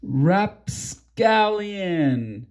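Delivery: slow, drawn-out rapscallion; mock pirate word. Pirates making the friends talk, slow mock pirate word. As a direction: stretch rapscallion out slowly. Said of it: "make us ... say things like".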